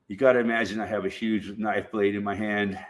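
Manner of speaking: ordinary talk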